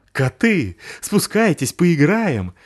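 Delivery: high, coaxing; playful dog calling. Acted. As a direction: lure the cats down. Пёс зовёт котов. (зазывающий пёс)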